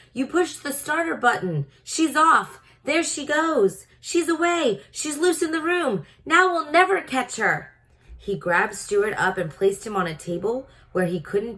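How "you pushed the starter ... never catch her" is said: high, fast-running alarmed cries; panicky. A narrator's reading. Dentist crying out as the invisible car escapes. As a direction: chase the runaway car with your voice.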